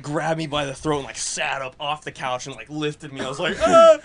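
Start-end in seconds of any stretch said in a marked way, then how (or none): 3.6-4.0 mock startled scream. Lifted by the throat, retold.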